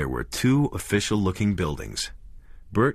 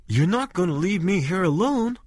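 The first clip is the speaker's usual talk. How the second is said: frightened plea; 12 semitones higher than usual. A narrator's reading. Scared wife in empty town.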